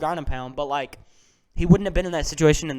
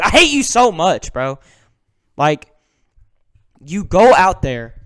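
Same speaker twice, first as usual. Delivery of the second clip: higher, much louder outburst of real hate; angry shout; 4 semitones higher, 12 dB louder, slower than usual. Real, not acted. Fan yelling at the cheating fighter, real angry shout. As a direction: yell it at the fighter you can't stand.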